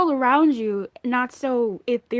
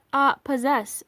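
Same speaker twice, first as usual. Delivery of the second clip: ordinary talk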